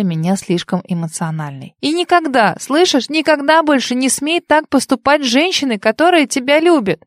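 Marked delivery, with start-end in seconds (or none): 1.8-7.0 angry crying. A narrator's reading. She scolds him in tears.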